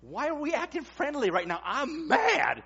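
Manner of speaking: loud, high-pitched comic indignation; hammed-up protest, high and loud; mock mad. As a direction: act out suddenly remembering you're angry. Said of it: "you remember you were mad".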